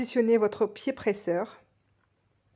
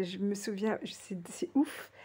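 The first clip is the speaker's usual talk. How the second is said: whispering; 8 dB quieter than usual. Real, not acted. Amused aside to herself.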